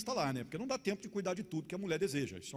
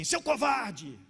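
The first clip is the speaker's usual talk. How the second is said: loud, high mock shout; fierce wife; 5 semitones higher, 8 dB louder, much slower than usual. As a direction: imitate a fierce little wife hurling an insult. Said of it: "E ela falava assim".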